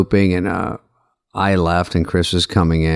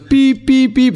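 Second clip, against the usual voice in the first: imitated beeps; 4 dB louder, much slower than usual. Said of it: "He goes, it goes".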